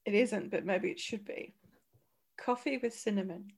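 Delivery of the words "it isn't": ordinary delivery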